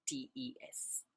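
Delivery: hushed letter-by-letter spelling; whispered spelling. Shown as a demonstration. Spelling out a verb ending.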